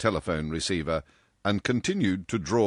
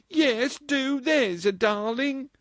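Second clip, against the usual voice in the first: put-on eager girlish plea; 3 dB louder, slower than usual. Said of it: "cried Marjorie".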